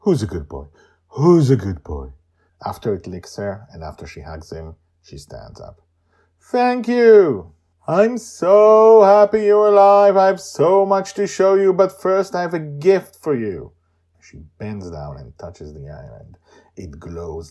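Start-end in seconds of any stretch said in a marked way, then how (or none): 0.0-2.1 cooing. Acted. Joy cooing to tortoise. 6.4-13.7 high, loud overjoyed reunion; joyful. Acted. Joy reunited with the tortoise, high and joyful.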